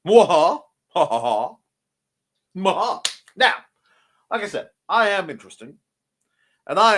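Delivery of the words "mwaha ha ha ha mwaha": hammy evil laugh; mustache twirling villain. Pretend. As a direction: cackle like a cartoon villain. Villain laugh after stalking joke.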